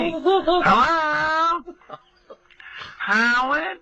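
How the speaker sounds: high, drawn-out calls of hello; imitating mom calling into the machine; mother calling hello. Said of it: "she's yelling into the answering machine".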